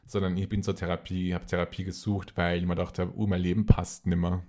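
ordinary talk

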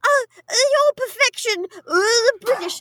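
high-pitched hammed-up English child; bad English accent. Kids greeting the new nanny, high bad English accent. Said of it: "Anyway, bad English accents".

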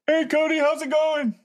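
squeaky high cartoon greeting; goofy clown voice, way up high; silly clown. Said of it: "The hell is that?".

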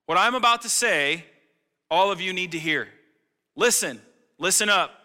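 loud, high "hear ye" proclamation; town crier. Retold or imitated. Pastor imitates a crier calling everybody, loud and high. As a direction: call out to the whole square like an old herald. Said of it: "hearkening back to old times of like".

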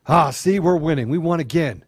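normal talking voice

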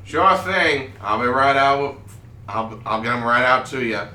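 cook voice. Acted. Cook answering from the kitchen.

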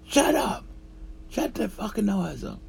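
high-pitched angry yell; imitated upset outburst. Upset dude bursting in on kitchen workers, angry yelling. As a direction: mimic someone bursting in furious. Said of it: "came in there all upset".

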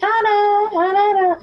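high sing-song calling a name. Retold or imitated. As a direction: call her name from across the house. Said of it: "She's like".

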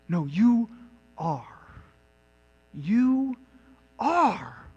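"you" lifted and held, "are" dropped after a pause; weighty declarative "you are"; emphatic you are. Shown as a demonstration. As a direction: split "you" and "are" with a beat. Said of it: "strong emphasis on the you".

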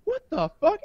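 disbelief. Retold or imitated. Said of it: "it's just like".

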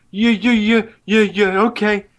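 mock the bad actor's put-on stutter, tripping on each d; fake stutter. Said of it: "the guy with the fake stutter".

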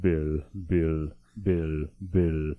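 low, evenly spaced robotic repeats. Retold or imitated. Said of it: "More robotic".

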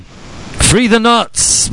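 high, drawn-out slogan call; mock rallying cry. Host echoing a call to free them, slow mock rallying cry.

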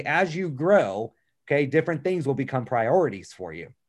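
ordinary talk

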